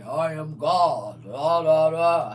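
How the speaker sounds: low, loud, slow booming proclamation; fake, pompous deity. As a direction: puff it up like a self-important god. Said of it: "didn't act all fake and phony and say".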